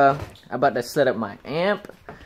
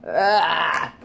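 pained yelp; 3 semitones higher than usual. Real, not acted. Licking a nine volt battery.

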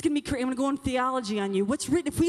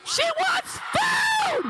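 high, slow shout to the crowd; announcing shout; 3 dB louder, much slower than usual. Real, not acted. Speaker repeating a girl's answer to the crowd, shouting. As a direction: belt it out for the room.

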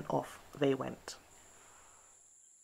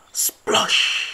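whispered splosh; 10 dB louder than usual. A narrator's reading. Sound effect word.

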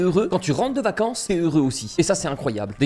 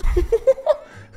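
high squealing laugh of disbelief; high-pitched laugh; 3 dB louder than usual. Real, not acted. Gamer stunned by the golden hen, high delighted laugh.

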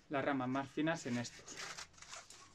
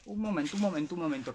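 sudden realization; 4 semitones higher, 5 dB louder, faster than usual. Real, not acted. Suddenly remembers something.

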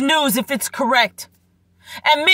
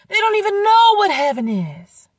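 raised and emphatic; emphatic preaching; 7 semitones higher than usual. Acted. Preacher repeats line three ways.

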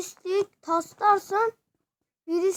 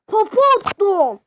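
excited yell; 5 semitones higher, 10 dB louder than usual. Real, not acted. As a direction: crow over the headbutt you just landed. Repeats the triumphant yell.

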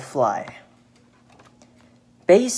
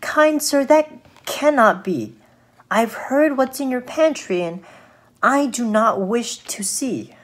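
higher-pitched little fly, wary; storybook fly, high and firm; little fly refusing; 7 semitones higher than usual. Acted. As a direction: decline politely in a raised fly voice.

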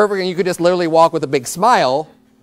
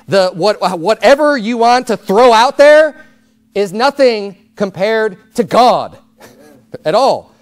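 rising, louder preacher's build; full-force preaching; 4 semitones higher, 4 dB louder, slower than usual. Acted. Preacher declaring God's power to the congregation, building. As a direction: preach it with mounting fire.

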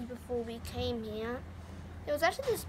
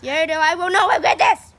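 shouts back, high and much louder; defiant shout; 5 semitones higher, 14 dB louder than usual. Acted. Boy shouting defiance back at Yoda. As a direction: stand up to Yoda at full volume.

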